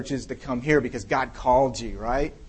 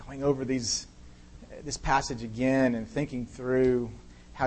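prayer; 3 dB quieter than usual. Real, not acted. Pastor praying over the congregation, soft and earnest. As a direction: pray quietly and earnestly before God.

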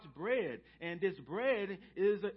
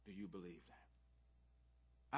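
slow and soft, almost whispered; slow hushed question; 11 semitones lower, 17 dB quieter, much slower than usual. Real, not acted. Preacher asking the congregation softly and slowly. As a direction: ask it gently and let it hang.

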